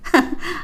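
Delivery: short soft laugh; low-key amused chuckle; brief chuckle. Acted. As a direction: laugh off her worry quietly. Brother laughs off her fear.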